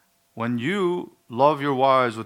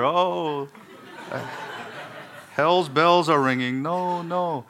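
inner dread; 5 semitones higher, slower than usual. Retold or imitated. Husband dreading the shopping trip, hammed-up mock dismay. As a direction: groan it out as the reluctant husband. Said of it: "And I over here".

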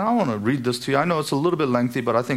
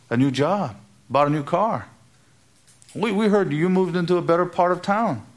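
bright, higher mock-gushing congratulations; gushing neighbour; 5 semitones higher than usual. Preacher mimics people praising a new house, higher and gushing. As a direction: lightly play a neighbour impressed by a new house. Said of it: "That's what they would say".

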